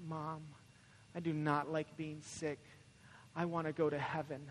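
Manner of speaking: soft, low-key mock complaint; small, subdued voice with pauses; imitating sick child. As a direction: quietly play a poorly boy confiding in mom. Sick son confiding in mother.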